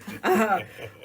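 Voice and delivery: bright burst of laughter; short real laugh. Real, not acted. Host teasing Facebook viewers, laughing.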